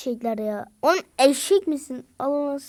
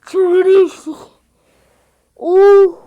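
high, loud exclamations; excited yell; 6 semitones higher, 16 dB louder, much slower than usual. Real, not acted. Gamer yelling in the heat of battle. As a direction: cry out in game frenzy. Frantic moment in game.

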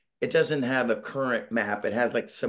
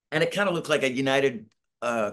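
normal talking voice

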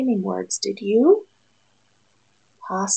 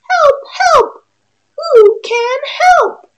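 high, loud cry for help; a stuck duck yelling high and loud; squeaky desperate duck shout; duck calling for help; 9 dB louder than usual. Acted. Duck cries out in story.

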